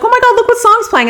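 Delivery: very high, quick excited squeal. Retold or imitated. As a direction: gush like a fan hearing her song. Driver thrilled by song on radio.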